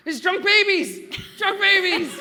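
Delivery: panicked shout. Acted. Johnny Darkfist yells up from the sewer in panic. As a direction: scream it in a panic. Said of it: "a deep, panicked, resonant voice shout".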